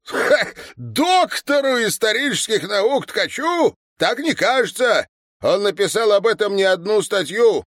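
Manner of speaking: high, loud accusing tirade; old veteran haranguing passers-by; indignant harangue. A narrator's reading. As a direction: build into a worked-up denunciation. Old man's harangue against Tkach.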